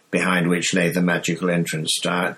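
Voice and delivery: ordinary delivery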